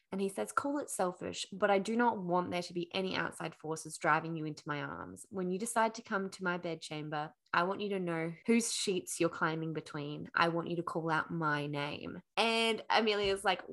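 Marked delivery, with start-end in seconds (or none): 0.5-12.2 low, softened lover's declaration; a hint of a brooding prince, low and hushed; lightly voiced intimate prince.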